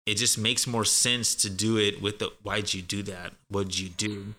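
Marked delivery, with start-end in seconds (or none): 2.4-4.3 "Why'd you" and "What'd you" linked as "whydju", "wudju"; softer, lower, blended "What'd you do"; native blended form. Shown as a demonstration. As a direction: casually run "Why'd you" together. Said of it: "why we speak like that".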